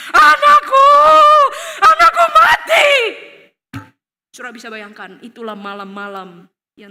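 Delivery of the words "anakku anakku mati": high, full-force acted wail; very loud, high cry of grief; anguished scream. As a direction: scream as a parent whose child is killed. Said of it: "papa mama itu akan berteriak".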